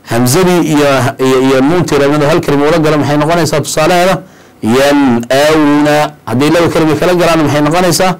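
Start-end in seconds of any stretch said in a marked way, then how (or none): 4.8-6.0 "Yan'awna" drawn out very slowly on a level tone; slow tajweed example. Shown as a demonstration. Teacher gives one-word example to students, "Yan'awna" slow and clear. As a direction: recite "Yan'awna" long and distinct, clear n before the hamza.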